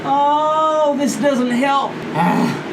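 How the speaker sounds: long, high-pitched moan of agony; pained wail. Real, not acted. Hot sauce sufferer finds no relief, pained wail. Burning mouth, remedy fails.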